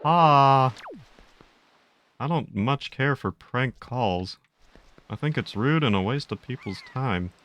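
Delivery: slow, disapproving cartoon dad; measured folksy disapproval. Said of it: "Hank Hill, think of the jerky boys".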